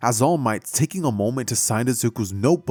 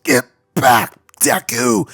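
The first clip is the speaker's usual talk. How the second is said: loud, raised warning shout; yelling; 8 dB louder, slower than usual. Acted. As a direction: bark it from inside the sludge. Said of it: "yelling out".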